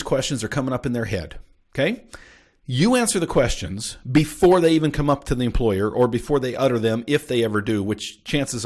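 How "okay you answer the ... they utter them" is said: ordinary talk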